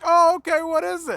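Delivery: high, perked-up woman's voice imitating her; imitating a lady's chirpy question; customer's surprised reply. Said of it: "She's like".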